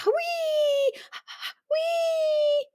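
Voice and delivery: very high squeaking wee; squeaky snore. Shown as a demonstration. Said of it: "Other times it's a".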